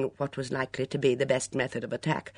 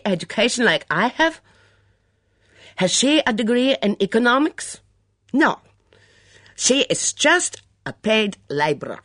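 put-on higher, louder, outraged Mitzi in her accent; indignant foreign accent; 5 semitones higher, 6 dB louder than usual. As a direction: scorn the gardener in a thick foreign accent. Mitzi voiced by narrator.

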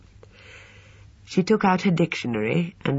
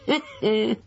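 put-on high old man's cackle; whinnying laugh; 9 semitones higher than usual. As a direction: cackle with glee. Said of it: "a high whinnying laugh".

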